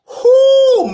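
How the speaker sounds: a loud, high whoop of joy; excited whoo. Real, not acted. Host thrilled by the loan news, loud high whoop. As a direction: whoop it out in delight.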